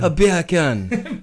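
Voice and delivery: slow, drawn-out British accent retry with a laugh; a second go at a British "A beer can", drawled. Shown as a demonstration. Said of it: "You just said bacon in a Jamaican accent".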